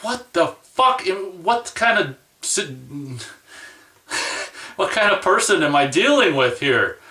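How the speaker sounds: as if you can't believe what you're hearing; incredulous outburst. Retold or imitated. Reacting to the smug interviewer, incredulous laughing disbelief.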